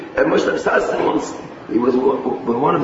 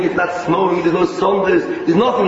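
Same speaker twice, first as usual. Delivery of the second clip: ordinary talk, nothing marked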